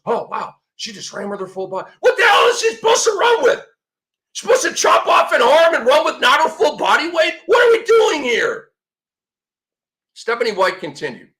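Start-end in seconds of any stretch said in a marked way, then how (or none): 2.0-8.7 much higher, louder incredulous yelling; exasperated outburst. Real, not acted. Host ranting at the team's update, exasperated shouting. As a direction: demand an answer in disbelief.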